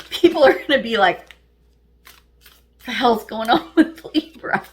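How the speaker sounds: laughing through the words. Real, not acted. Tarot reader laughing at how people will react. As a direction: giggle while you say it.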